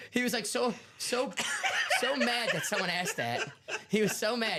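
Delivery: high, giggly retelling through laughter; cracking up mid-sentence; laughing while telling. Real, not acted. Cohost laughing while retelling the angry host.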